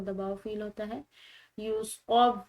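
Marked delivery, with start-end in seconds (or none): 1.6-2.3 يُصَوِّرُكُمْ with pressure on the doubled و; stress on the shadda. Shown as a demonstration. Teacher pressing the doubled و in يُصَوِّرُكُمْ, louder. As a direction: lean hard on وّ in يُصَوِّرُكُمْ. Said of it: "اس پر pressure دیں گے".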